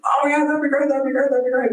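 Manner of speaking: put-on high, squeaky delighted little boy; excited child voice. Son answering dad's offer, squeaky and delighted. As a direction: play the kid thrilled to be asked.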